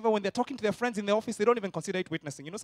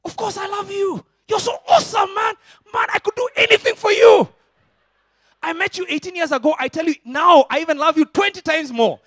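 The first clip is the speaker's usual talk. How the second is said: much higher and loud, near shouting; gushing answer; 12 semitones higher, 11 dB louder than usual. Shown as a demonstration. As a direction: pour out love for your wife. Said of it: "The correct answer is".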